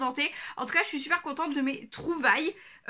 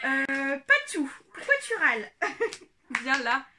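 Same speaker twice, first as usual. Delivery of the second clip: talking to the pet. Real, not acted. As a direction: coax the dog gently. Talking to her pet Patou. (voix douce pour parler au chien)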